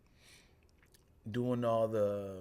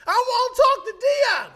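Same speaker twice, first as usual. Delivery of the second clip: high, loud insistent voice; insistent demand; 15 dB louder than usual. Retold or imitated. Miss Calhoun demanding Dion on the phone, high insistent.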